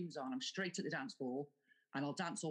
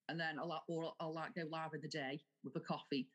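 ordinary delivery

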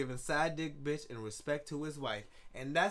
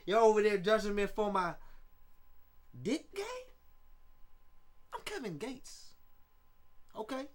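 lightly voiced rapper swagger, pitched up; 4 semitones higher than usual. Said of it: "How was my [name] impression".

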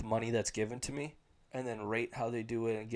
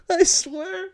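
laughing, high; 10 dB louder than usual. Real, not acted. Cracking up again.

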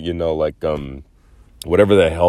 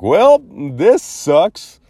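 mock dismay; 9 semitones higher, much slower than usual. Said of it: "I was all like".

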